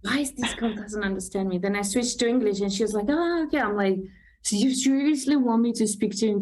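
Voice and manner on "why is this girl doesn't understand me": usual speaking voice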